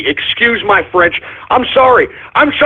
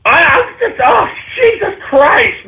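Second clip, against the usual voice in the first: high, strained yell; exasperated shout; 10 semitones higher than usual. Real, not acted. Host fed up with viewers, exasperated shout. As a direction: burst out at the end of your rope.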